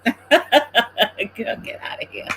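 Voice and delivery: helpless real laughter through the words; amused, giggly shooing-away; laughing. Real, not acted. Laughing at her daughter interrupting.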